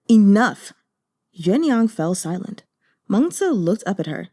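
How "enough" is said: a curt, louder "enough" to end it; sharp command. Acted. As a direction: shut her up with one firm word. Lady cuts off the maid.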